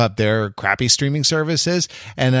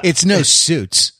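slow, laughing jab; laughing tease; 5 semitones higher, 3 dB louder, much slower than usual. Real, not acted. Co-host teasing Pete about his show pick, laughing.

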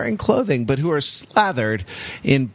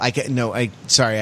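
normal talking voice

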